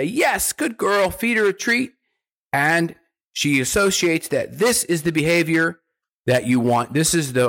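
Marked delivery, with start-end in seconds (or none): 0.0-1.9 bright, high puppy praise. Shown as a demonstration. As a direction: gush over the puppy's good deed. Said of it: "you say".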